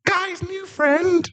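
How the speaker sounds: very high, eager puppy voice; excited dog boy. Acted. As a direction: yip it out like an overjoyed puppy.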